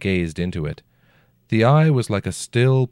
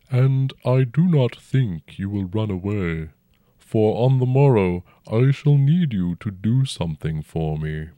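dragon voice; 3 semitones higher, 4 dB louder than usual. A narrator's reading. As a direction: speak as a huge old dragon, unhurried. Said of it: "the dragon said".

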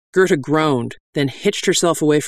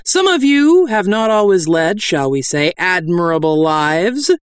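mustered authority; 4 semitones higher, 4 dB louder than usual. Acted. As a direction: preach with authority, opening high and loud. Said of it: "with as much authority as I could muster".

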